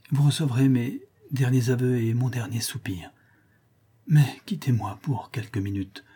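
barely breathing out her last wishes; faint dying voice. Acted. The dying marquise whispers brokenly to her lover. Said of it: "d'une voix éteinte, elle balbutia ces mots entrecoupés".

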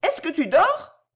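called-out question; calling out. A narrator's reading. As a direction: shout to wake a daydreamer. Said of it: "Bijou cria".

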